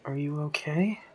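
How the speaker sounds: soft worried whisper. Acted. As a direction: gently whisper it. Whispering to frightened Maxwell.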